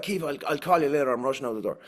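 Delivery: imitating himself hurrying in a strong Irish accent; strong Irish accent, rushed. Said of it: "my accent was particularly strong".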